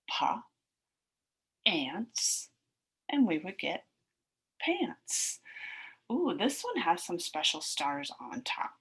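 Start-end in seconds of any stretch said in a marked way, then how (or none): 0.1-2.5 pa then ants, ants nearly whispered; sounding out. Shown as a demonstration. Teacher sounding out pants for the class, separate chunks. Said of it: "sound out like this". 4.6-5.4 pants said high; blended word. Shown as a demonstration. Teacher blending pa and ants for the class, high. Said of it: "sound out like this".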